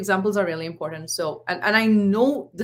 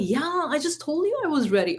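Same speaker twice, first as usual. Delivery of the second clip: put-on higher-pitched, exasperated teen reply; irritated retort; 7 semitones higher than usual. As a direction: mimic a son fed up with being asked. Son's irritated retort retold.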